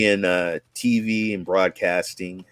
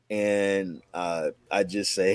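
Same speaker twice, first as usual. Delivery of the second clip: usual speaking voice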